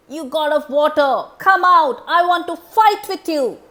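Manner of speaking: loud, high bellowing challenge; shouting challenge. Acted. Demon Dundubi shouting at the ocean god, loud challenge. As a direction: roar it out to pick a fight.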